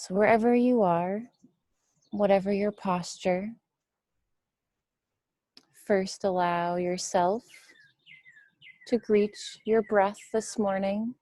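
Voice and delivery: measured meditation voice with long, spacious pauses; guided meditation. Real, not acted. Teacher guiding listeners to witness the breath, guided meditation. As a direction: guide them gently through the breath, leaving room between phrases.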